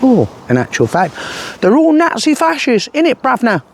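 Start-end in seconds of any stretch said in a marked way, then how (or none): 1.6-3.6 high, mocking London geezer; exaggerated fake Cockney; Tommy's fake London accent. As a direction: send up a London accent, pitched way up. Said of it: "I'm using this accent because Tommy's fake accent".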